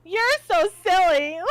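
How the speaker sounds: high, loud, drawn-out teasing; playful deflection. Retold or imitated. Acting out brushing off someone's confession, loud slow teasing. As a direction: laugh it off, stretching the words.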